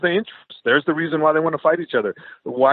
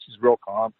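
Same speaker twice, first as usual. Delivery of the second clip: usual speaking voice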